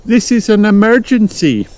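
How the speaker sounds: high-pitched urgent call; high storybook mum seeking help; Mummy Pig. A narrator's reading. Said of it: "Mummy Pig called for help".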